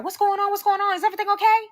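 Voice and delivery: loud, high-pitched rapid questioning; high, agitated mom voice; hostile mother. Retold or imitated. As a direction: imitate his mother demanding answers. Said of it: "she's very hostile".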